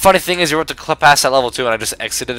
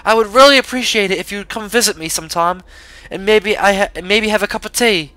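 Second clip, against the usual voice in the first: a lightly put-on British lady voice, a touch higher; mild British accent; 7 semitones higher than usual. Reading pastry owner Charlotte's letter, mild put-on British accent. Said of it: "My British accent is not on par today".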